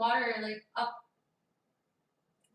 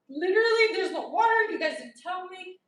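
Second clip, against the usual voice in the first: mad; 7 semitones higher, 9 dB louder than usual. Retold or imitated. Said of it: "I was, like, mad".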